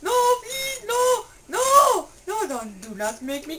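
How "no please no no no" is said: high, pleading hammed-up screams; hammed-up frightened shrieks; mock panic. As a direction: beg in a squeaky mock terror. Character panics at kitty attack.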